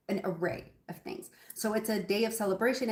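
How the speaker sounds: usual speaking voice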